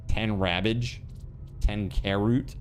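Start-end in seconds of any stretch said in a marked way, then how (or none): none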